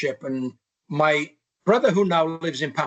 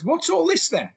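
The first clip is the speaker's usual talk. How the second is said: much higher, mock stern mum; imitating his mother; 14 semitones higher than usual. Mum questions her son about the forces pamphlets, in a high mock stern voice. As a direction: put on his mum's high voice, catching him out.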